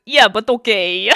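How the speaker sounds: loud mocking Italian-accent imitation; exaggerated Italian-sounding accent, loud; mock Portuguese-Italian accent. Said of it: "You sound like Portuguese Italian".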